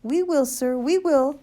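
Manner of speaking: high, loud, eager assurance; calling back. A narrator's reading. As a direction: shout back obligingly to the gentleman. Sergeant calling back over uproar.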